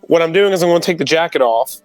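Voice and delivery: ordinary talk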